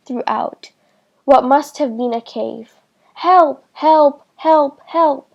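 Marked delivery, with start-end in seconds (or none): 3.1-5.3 calling for help. A narrator's reading. Trapped girl calling for help.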